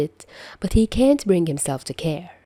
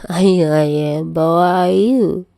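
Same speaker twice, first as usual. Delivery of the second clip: mocking; 5 dB louder than usual. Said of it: "He mocks".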